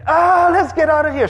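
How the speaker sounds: high, loud scared cry to flee; panicked yell. Retold or imitated. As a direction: yell to run from the storm. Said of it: "We're like".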